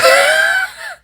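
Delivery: sudden high squeal of a laugh; high-pitched laugh. Real, not acted. Burst of laughter mid-talk.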